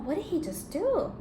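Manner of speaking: usual speaking voice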